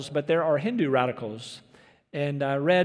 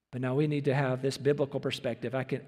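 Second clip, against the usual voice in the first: ordinary talk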